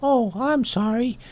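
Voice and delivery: high, slow tiny voice; apologetic little ant. Acted. Ant apologising to the bull, high little voice. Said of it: "And he says".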